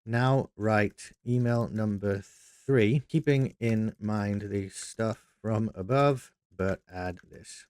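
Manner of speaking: slow, drawn-out dictation while typing; slow dictating. Shown as a demonstration. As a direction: spell each word out slowly as you type it.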